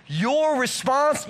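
"Your" stretched slow and lifted high; stress on your response. Shown as a demonstration. Pastor splitting the word for listeners, slow lifted "Your". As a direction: hold "Your" long before "response". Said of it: "think about the word responsibility".

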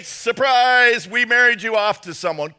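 high, loud sarcastic announcement; mock surprise. Pastor mocking the idea of a forced marriage, mock surprise. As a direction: stretch out the big fake reveal.

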